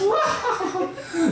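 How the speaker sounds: high cackle of an androgynous anime villain; evil anime villain laugh. Retold or imitated. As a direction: cackle like a cartoon evildoer. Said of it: "she laughs like some androgynous evildoer".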